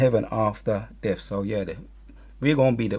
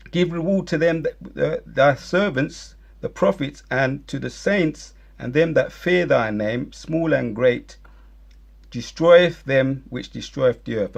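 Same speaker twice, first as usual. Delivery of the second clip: slower and louder preached scripture; preaching the verse; 3 semitones higher, 4 dB louder than usual. Acted. Preaching the verse at his enemies, fervent. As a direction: declaim the verse with force.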